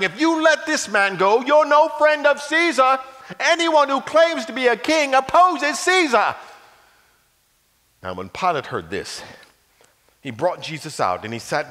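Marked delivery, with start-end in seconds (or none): 0.0-6.3 loud, high insistent yell; crowd shouting. Acted. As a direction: keep shouting at Pilate.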